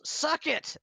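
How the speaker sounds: high, loud taunting yell. Acted. Gelvik yells back at the intercom villain, high and loud. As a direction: shout it at the villain. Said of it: "Gelvik yells".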